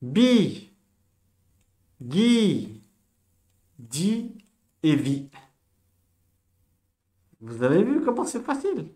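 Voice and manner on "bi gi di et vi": "bi", "gi", "di" pronounced separately, loud and clear; "bi", "gi", "di", "vi" called out one at a time; sounding out syllables. Shown as a demonstration. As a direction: model each syllable for the class. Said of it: "c'est une confirmation".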